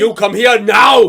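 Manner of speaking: slow, loud broken English command; broken English shout. Pretend. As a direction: slowly bark it like Arnold. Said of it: "in broken English".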